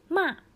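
まあ said high then dropping sharply, like a clean downward hit; まあ starts high and falls hard to the bottom; falling fourth tone. Shown as a demonstration. Teacher demonstrating the Chinese fourth tone, sharply falling. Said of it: "もう下に打ちっぱなしの感じで".

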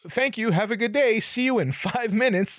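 high, bright customer-service politeness; overly polite goodbye. Retold or imitated. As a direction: chirp it with a laugh. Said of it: "be like".

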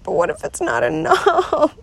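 high tearful question collapsing into sobs; real crying. Real, not acted. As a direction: worry aloud through tears. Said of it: "I'm starting to cry".

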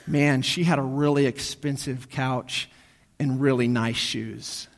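low, soft, slow mourner's tribute; mock eulogy. Imagined mourner speaking at her funeral, low slow mock eulogy. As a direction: deliver a hushed, drawn-out mock tribute.

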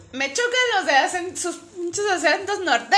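mimicking a complaining cousin. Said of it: "acá dijo".

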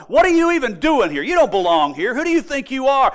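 loud, high, indignant challenge; confrontational challenge. Acted. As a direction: challenge the outsider at full voice, as if speaking for the crowd. Acts out spirit challenging Jesus.

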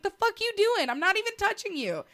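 high, baffled outburst; incredulous protest. Retold or imitated. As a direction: protest like the accused boyfriend. Neighbor's quarrel retold.

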